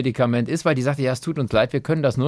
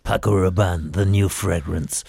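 deep, slow sultry voiceover; perfume ad voice; 9 semitones lower, slower than usual. Pretend. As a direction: purr it like a fragrance ad.

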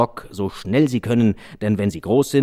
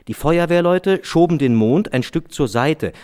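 ordinary delivery, nothing marked

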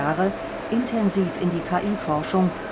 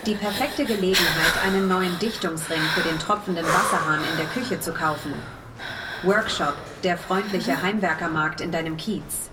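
ad voice. Acted. KI-Werbestimme spricht Daya an.

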